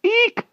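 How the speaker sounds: very high squeaky eek; mouse squeak. A narrator's reading. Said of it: "said Merlin, hoping that this would do".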